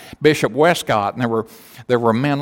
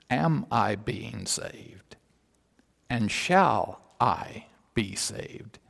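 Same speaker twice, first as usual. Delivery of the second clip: measured bishop; 2 semitones lower, 7 dB quieter, slower than usual. Acted. Bishop answers young lady about being saved, slow and measured.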